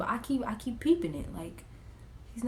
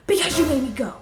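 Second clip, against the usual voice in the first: put-on loud, high bratty kid voice; 7 semitones higher, 8 dB louder than usual. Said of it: "He was like".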